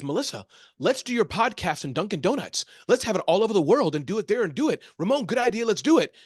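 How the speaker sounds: eager, emphatic pitch with swooping highs; excited pitch. Retold or imitated. Big dreamer pitching podcast ideas to Melissa, excited.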